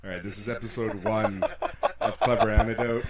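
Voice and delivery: loud laughing announcement. Real, not acted. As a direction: burst out laughing as you announce it. Loud laughing show opener.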